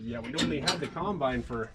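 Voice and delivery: ordinary talk, nothing marked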